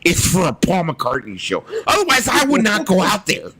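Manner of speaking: Richard Dolan voice. Retold or imitated. Prank Alexa ranting in Richard Dolan's high voice. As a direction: shout the weather like Richard Dolan. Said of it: "the voice of Richard Dolan".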